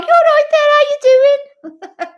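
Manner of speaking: high, loud Coventry accent put on; mock-boozy Coventry greeting, shrill and loud; drunken Coventry accent. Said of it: "when I've had a drink".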